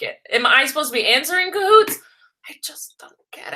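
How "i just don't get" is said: confused whisper. Real, not acted. Confused about the Kahoot quiz.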